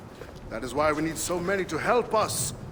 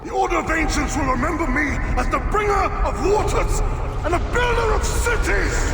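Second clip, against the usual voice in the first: loud, high, rising to a cry; crazed boast at the end; triumphant dying boast; 12 semitones higher, 7 dB louder than usual. Acted. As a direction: shout your legacy to the sky.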